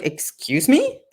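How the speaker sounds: affronted, drawn-out excuse me; taken aback, questioning; incredulous. Retold or imitated. Patient reacting to the doctor's verdict, incredulous.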